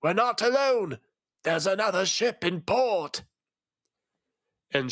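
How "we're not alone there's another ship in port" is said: urgent call, much higher, not whispered; hoarse whisper. A narrator's reading. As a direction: cry out to the captain, high and urgent. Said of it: "called out in a hoarse whisper".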